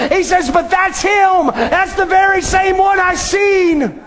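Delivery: shouting testimony from the pulpit; fervent preaching. Acted. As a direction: preach it out with full fervor. Preacher voicing the saints.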